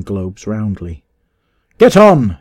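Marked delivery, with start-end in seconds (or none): 1.8-2.4 loud, high, impatient order; crying out. A narrator's reading. Master urging his men on, crying out impatiently. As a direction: shout the horses onward. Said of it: "he cried".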